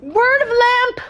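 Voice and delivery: high, slow excited exclamation; excited reading. Real, not acted. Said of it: "Words of lamp".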